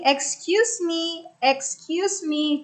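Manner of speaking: slow, careful "Excuse me", each syllable clear; slow teacher demo of "Excuse me"; careful pronunciation. Shown as a demonstration. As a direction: model "Excuse me" slowly for the class. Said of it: "Cara pengucapannya".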